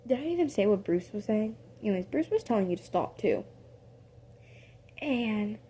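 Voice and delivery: usual speaking voice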